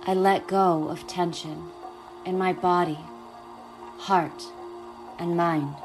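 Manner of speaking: calm, unhurried guiding voice; guided meditation. Acted.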